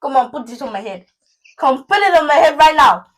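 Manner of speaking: sarcastic mom, building to a loud shout; hammed-up Nigerian mother's telling-off; exasperated Nigerian mom. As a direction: snap loudly at a clueless child. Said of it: "a Nigerian mom sent you".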